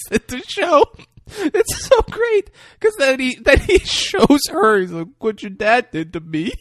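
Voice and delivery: high, giggly retelling; can barely get it out for laughing; laughing excitedly. Real, not acted.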